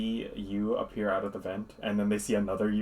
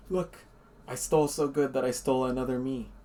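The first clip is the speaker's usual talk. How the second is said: proud boast; 4 semitones higher, 4 dB louder than usual. Acted. Duplicate boasts to the group.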